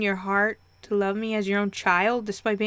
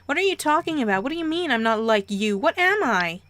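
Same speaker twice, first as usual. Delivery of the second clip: high, loud bewildered questions; rising, urgent disbelief; acted alarm; 4 semitones higher, 4 dB louder than usual. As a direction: demand answers, pitch jumping up. Teen panics at parents' revelation.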